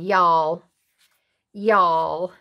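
loud, drawn-out Southern "y'all"; Paula Deen. Retold or imitated. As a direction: imitate a TV cook's Southern catchphrase, lightly. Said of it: "she's always saying".

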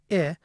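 "I" said as short "ih"; isolated short "ih" for "I"; lax front vowel demo. Shown as a demonstration. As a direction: say "I" like the vowel in bit. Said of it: "some productions of, say".

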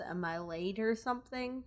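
ordinary talk

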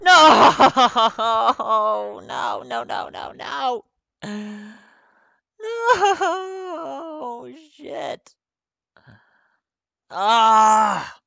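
real high panicked screams, wailing no's; loud shrieks breaking into wailing. Real, not acted. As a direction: scream in high-pitched despair at losing the game. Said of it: "my fucking screaming like a little girl".

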